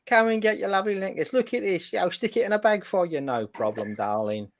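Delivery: high, hammy Cockney market trader; bad English accent. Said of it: "appalling attempt at an English accent".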